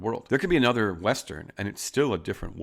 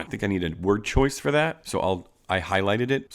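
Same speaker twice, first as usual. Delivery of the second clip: ordinary talk, nothing marked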